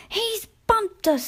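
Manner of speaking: high squeaky coach voices screaming; screaming coaches. A narrator's reading. Coaches screaming at Sir Handel after a bump, high squeal. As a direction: shriek it like spiteful coaches. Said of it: "screamed the coaches".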